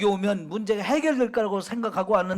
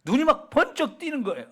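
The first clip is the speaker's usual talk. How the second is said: usual speaking voice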